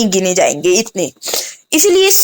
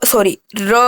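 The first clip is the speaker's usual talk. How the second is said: ordinary talk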